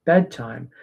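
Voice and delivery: soft hushed shushing to a crying puppy; calm the puppy down gently at the crate; quiet reassuring bedtime murmur; soothing hush. Shown as a demonstration. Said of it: "anything that's gonna be reassuring".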